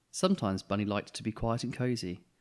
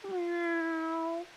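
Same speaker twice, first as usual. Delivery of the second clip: very high, thin cat meow. Acted. The cat answering from the rain, a small high meow. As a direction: mew like a wet cat. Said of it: "they heard a meow".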